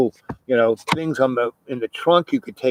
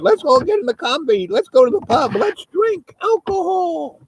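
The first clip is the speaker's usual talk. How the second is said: squeaky high pig voice, slowing on the last line. Retold or imitated. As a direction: play the animals begging to go to the pub in a high squeal. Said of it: "the pigs were going".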